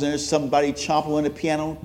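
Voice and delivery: normal talking voice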